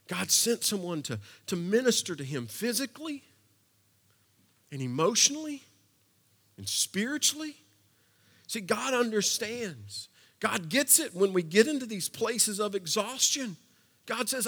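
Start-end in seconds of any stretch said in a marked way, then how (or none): none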